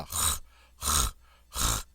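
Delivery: h rasped from the back of the throat; guttural French R. Shown as a demonstration. Teacher demonstrates the French R, h gargled in the throat. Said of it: "Guttural from the back of the throat".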